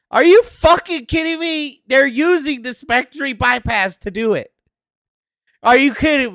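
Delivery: high, loud exasperated shout; angry disbelief. Real, not acted. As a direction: yell at the screen in disbelief. Reading the exploit details.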